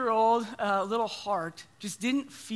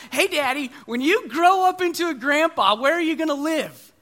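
put-on higher, louder kid voice; curious little boy; 5 semitones higher, 6 dB louder than usual. Son at the dinner table asking dad, high and eager. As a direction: ask it like an eager small boy. Son's question voiced again.

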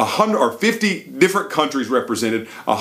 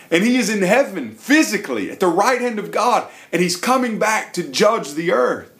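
rising fervent preaching; 6 semitones higher than usual. Retold or imitated.

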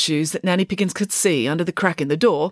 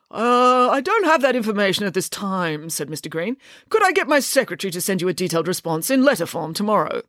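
prim, pompous father laying down rules; a fussy lawyer giving orders; father instructing the children before a visit, pompous; stiff lawyer father; 3 semitones higher than usual. Acted. Mister Green voiced.

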